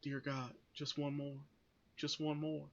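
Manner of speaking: quiet imitated Southern accent; hushed Southern-accented plea; Southern accent impression. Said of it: "he has to do a Southern accent".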